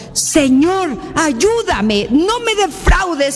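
high, drawn-out plea to God; acted imploring cry, slow and stretched; retold desperate cry. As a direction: beg for help, pitch soaring. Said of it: "hincada le gritó".

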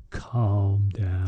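low, soft, steadying voice; calm reassurance. Retold or imitated. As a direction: settle a panicking friend down. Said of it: "grabs me and says".

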